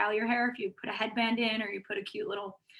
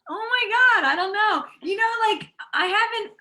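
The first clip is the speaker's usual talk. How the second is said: flustered giggly squeal; 8 semitones higher than usual. Real, not acted. Flustered by celebrity crush question.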